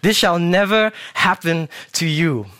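high, slow, emphatic refusal; exclaimed rebuke. Acted. Peter rebuking Jesus for predicting his death, forceful. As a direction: drive each word home in protest. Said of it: "Exclamation mark".

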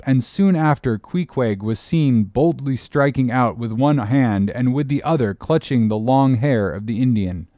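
usual speaking voice

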